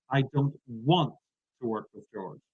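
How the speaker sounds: "want" stretched out with a pause after it; stress on WANT. Shown as a demonstration. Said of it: "I change the emphasis".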